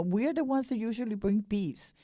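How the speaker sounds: usual speaking voice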